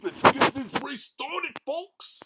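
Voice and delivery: high, excited cartoon dog; Goofy voice.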